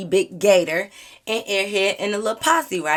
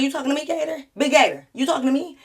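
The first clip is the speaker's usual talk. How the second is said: confrontational; 7 semitones higher, faster than usual. Retold or imitated. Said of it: "I was like".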